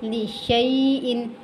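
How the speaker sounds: लिशैइन slowly, sh into a held ay sound; clear ya. Shown as a demonstration. Teacher models for learners, slow distinct ya. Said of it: "या की आवाज़ वाज़े तौर पर".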